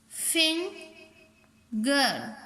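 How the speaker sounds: "Finger" very slow, long gap before गर; split into syllables. Shown as a demonstration. Teacher counting two syllables, "finger" slowly in two parts. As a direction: break "finger" into फिं and गर. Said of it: "टू syllable".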